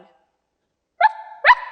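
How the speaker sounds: high, loud yappy bark; sharp dog bark. A narrator's reading. Dog barking at Mary Poppins, sharp. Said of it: "barked sharply".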